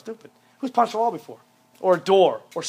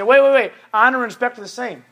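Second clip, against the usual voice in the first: mock objection; 5 semitones higher, 7 dB louder than usual. Objecting student voiced.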